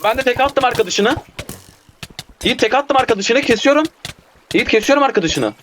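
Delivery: high-pitched, urgent report to a teammate; excited kill callout. Real, not acted. Gamer telling Yiğit he is taking down an enemy, excited high callout.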